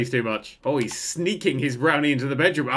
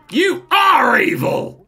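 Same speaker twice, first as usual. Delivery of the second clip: slow, loud, drawn-out accusation; hammed-up villain denunciation; mock outrage; 6 semitones higher, 7 dB louder, much slower than usual. [name] watches Aladdin remake.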